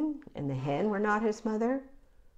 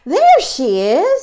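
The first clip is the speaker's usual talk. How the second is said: high, loud, stretched-out cry; thrilled little bird, loud and slow; excited baby bird; 7 semitones higher, 13 dB louder, slower than usual. Acted. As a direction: call out the joyful discovery. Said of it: "said the baby bird".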